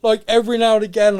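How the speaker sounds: normal talking voice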